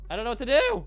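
loud panicked cry. Real, not acted. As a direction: blurt it out in panic. Repeats louder in panic.

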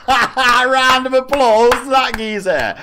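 high, loud, long-drawn holler; slow, stretched celebratory shout; rallying shout. Real, not acted. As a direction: call for applause like a hype man. Whipping up applause after donation.

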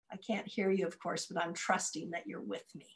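ordinary delivery, nothing marked

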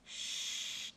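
soft unvoiced hush; whispered shush. A narrator's reading. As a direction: breathe the shush. Hush in the night.

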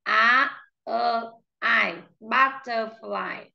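Á, ơ, ai apart, then slow "butterfly"; sound-by-sound demo. Shown as a demonstration. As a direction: break "butterfly" into its vowels, then say it slowly. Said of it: "Âm thứ nhất là âm Á". (đọc tách Á, ơ, ai rồi "butterfly")

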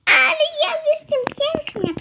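put-on very high, girlish princess squeal of delight; delighted gushing. Dressed-up daughter admires herself, high delighted gushing. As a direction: gush in a high little voice.

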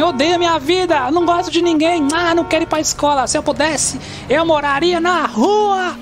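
high, whiny teenager voice mocking the viewers; mock moody teen. Gamer mocks his viewers as a sulky teen, high whiny voice. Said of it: "tô imitando vocês".

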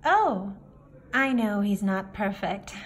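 ordinary delivery

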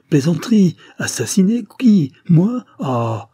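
high, loud, panicked questions; strangled voice. A narrator's reading. Lambert accused of murder, strangled protest. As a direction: choke out protests, pitch leaping, then drop away. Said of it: "dit Lambert d'une voix étranglée".

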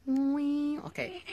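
high playful squeal. Real, not acted. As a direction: squeal it gleefully. Playful squeal while moving.

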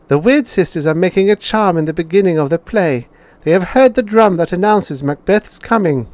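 put-on high, quick child's explanation; eager pupil. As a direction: rattle it off like a keen pupil.

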